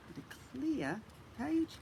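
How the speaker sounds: high, slow, marvelling; amazed. Real, not acted. Marvelling to child at trick.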